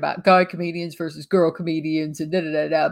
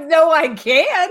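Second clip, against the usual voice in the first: laughing no; 7 semitones higher, slower than usual. Real, not acted. Laughing refusal of the joke.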